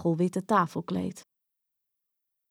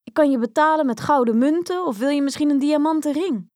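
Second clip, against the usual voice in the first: higher, louder pleading princess voice; eager princess; 7 semitones higher, 5 dB louder, faster than usual. Acted. Princess offering payment to the frog, eager princess. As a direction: bargain eagerly as a young royal.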